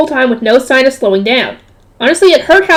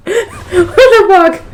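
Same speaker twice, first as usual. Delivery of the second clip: slow, laughing what the; real laugh cracking into the words; laughing disbelief; 6 semitones higher, much slower than usual. Real, not acted. Reacts laughing to the story.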